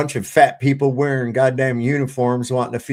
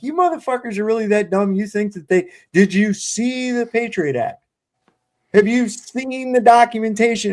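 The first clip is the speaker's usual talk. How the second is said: higher-pitched, incredulous retort; exasperated challenge; 6 semitones higher than usual. Retold or imitated. Retelling a comeback to conspiracy-theory scoffers, exasperated challenge. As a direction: fire back at the doubters in disbelief.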